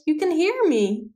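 high, incredulous question; surprised cry. A narrator's reading. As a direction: ask in surprise, pitch lifting high. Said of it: "cried the princess".

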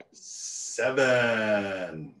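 usual speaking voice

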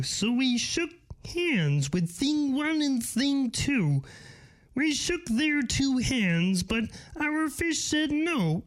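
earnest cartoon frog, pitched well up; Kermit the Frog. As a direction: draw out the vowels like a frog puppet. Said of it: "my choice is Kermit the Frog".

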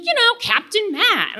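high, emphatic reminder; excited friend. Retold or imitated. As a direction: play up the big name. Friend insists on Captain Matt.

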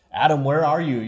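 laut und hoch gerufen; calling out. Retold or imitated. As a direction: ruf nach Adam, als suchst du ihn. God calling out to Adam.

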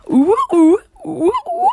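high, loud mocking hoots; loud high hooting laugh. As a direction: hoot like a gleeful monkey. Mock monkey-like hooting.

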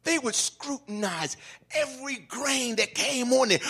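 ordinary talk

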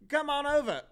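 high, loud hammed-up Cockney; Cockney accent. Killer luring the wife over, high Cockney. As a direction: sing it out in a bad London accent. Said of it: "Is that better?".

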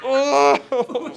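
loud, high pained oh oh; pained groan. Pretend. Reacting to hearing pucha, a loud mock pained groan. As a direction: groan as if the word hurts you. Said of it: "Me duele, así, me duele".

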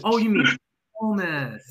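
"pommes" given a lighter, two-syllable Swedish lilt, high and bright; a cashier finally getting it; cashier correcting. Retold or imitated. Cashier correcting coach's pronunciation, amused. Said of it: "And he goes".

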